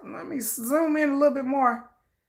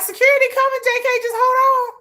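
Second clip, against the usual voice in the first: mock calling out; 11 semitones higher, faster than usual. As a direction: call out to reassure him from afar. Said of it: "I'm like".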